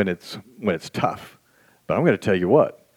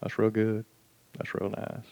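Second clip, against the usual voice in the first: unimpressed murmur; 10 dB quieter than usual. Retold or imitated. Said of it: "then you can go".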